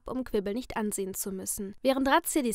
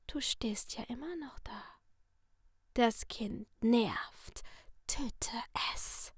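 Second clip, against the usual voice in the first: sinister masked figure in a soft whisper; menacing whisper from behind a mask; creepy whisper; 7 dB quieter, slower than usual. Acted. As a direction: purr the threat like a friendly killer. Letty whispers orders.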